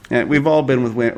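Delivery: ordinary talk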